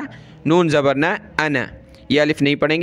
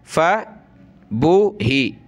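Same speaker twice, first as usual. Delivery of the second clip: Quran recitation; much slower than usual. Shown as a demonstration. Teacher recites the verse slowly for pupils. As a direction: intone it slowly like tilawat.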